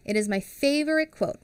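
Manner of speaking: usual speaking voice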